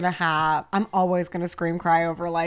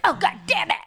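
mock scream; 8 semitones higher than usual. Imitating Joe yelling at sleeper on entering, mock scream. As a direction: imitate a cross yelp in a raised pitch. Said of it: "screams at me".

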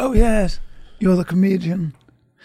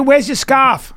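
mock Boston girl; 4 semitones higher, slower than usual. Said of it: "A chick from Boston".

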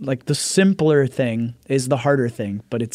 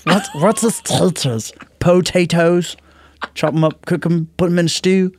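Gollum taters line; 4 semitones higher than usual. Retold or imitated. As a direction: sneer at potatoes like Gollum. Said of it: "I do voices".

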